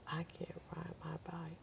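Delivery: whispered, tearful repeat of the plea; crying child. Retold or imitated. Daughter repeating her plea to mother, whispered and tearful. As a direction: barely breathe it out like a frightened child. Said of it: "She says".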